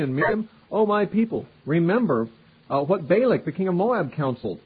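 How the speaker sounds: pleading scripture reading. Acted.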